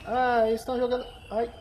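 usual speaking voice

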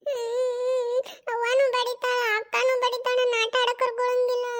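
tearful sing-song wail; sulky child's crying complaint. Acted. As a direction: whine it out, dragging each phrase ending. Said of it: "ಅಳಬಾರ್ದಪ್ಪಣ್ಣ, ಎದಕ್ ಅಳ್ತಿ?".

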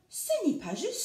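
a touch of sulky sadness; sad. A narrator's reading. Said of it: "songea-t-il d'un air triste".

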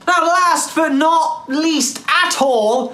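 high, loud, slow hype; excited announcement. Acted. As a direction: build it up like a showstopper. Announcing the final topic.

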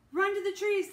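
high, very loud cry to flee; urgent shout. A narrator's reading. Annie urges Jack away from the tiger, urgent shout. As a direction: shout the order to run.

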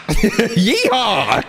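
cowboy yeehaw. Pretend. Texas joke, cowboy call.

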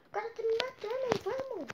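panicked high plea; being chased. Real, not acted. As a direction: sound hunted and urgent. Being shot in game. (em pânico, sendo perseguido)

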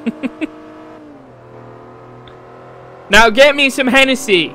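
badly faked American hillbilly accent, played for laughs; terrible American accent. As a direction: overdo a bad American hillbilly voice. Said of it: "such a terrible accent".

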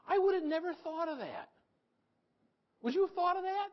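high, loud disbelief; astonished rhetorical wonder; amazed. Real, not acted. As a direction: marvel at the addicts' ingenuity.

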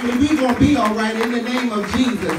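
rising preacher's call, loud and high; preaching. Real, not acted. Cousin rallying the funeral crowd, preaching loud. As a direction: stir the mourners up like a preacher.

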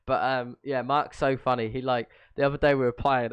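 usual speaking voice

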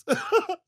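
high rising mocking cackle; high gloating cackle. Vampire cackling at a defeated enemy, high. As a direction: cackle at the fallen fool. Gloating triumph over a foe.

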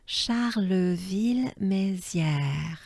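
Charleville-Mézières said slowly as a whole; slow model. Shown as a demonstration. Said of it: "Let's try as a whole".

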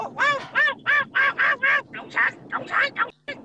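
very high-pitched yapping dog voice; mocking dog bark. Ranter mocks critics as dogs barking about communism, yapping. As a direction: yap the words like a little dog barking. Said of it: "lâu lâu cái là qua lên cái sủa".

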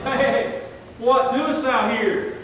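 God rousing a believer at night, loud and slow; loud God's wake-up call. Retold or imitated. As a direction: wake the sleeper with God's question. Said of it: "keep waking you up at night and saying".

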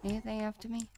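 ordinary talk

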